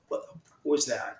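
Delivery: usual speaking voice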